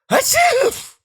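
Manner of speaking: very high loud fake sneeze; hammed-up shrieking sneeze. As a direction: let rip a huge sneeze. Third sneeze.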